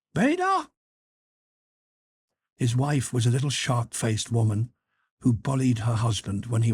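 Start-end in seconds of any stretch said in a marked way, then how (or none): 0.1-0.7 high call falling at the end; bawling upstairs. Acted. Husband bawls again for his absent wife, high falling call. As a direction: call her name again, dropping it. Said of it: "He bawled upstairs".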